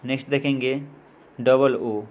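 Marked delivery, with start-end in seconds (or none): none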